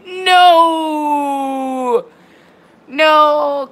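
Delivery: long drawn-out "no"; mock anguished wail. Gamer mourning the submerged performance car, mock anguished wail. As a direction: wail it out, stretching the vowel.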